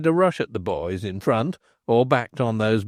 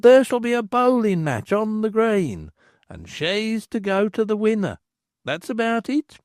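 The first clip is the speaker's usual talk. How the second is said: higher, chatty village bellman; bellman's voice; 10 semitones higher than usual. Acted. Bellman explaining the bowling match to the landlord, chatty and higher. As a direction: gossip it out like a town crier.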